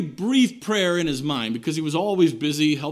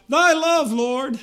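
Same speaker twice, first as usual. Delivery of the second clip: higher, louder pleading cry to the Lord; fervent cry; 9 semitones higher, 5 dB louder, slower than usual. Retold or imitated. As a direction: lift the plea up high, calling out to God. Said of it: "you can cry out".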